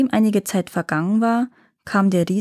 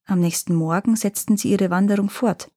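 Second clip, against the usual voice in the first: ordinary delivery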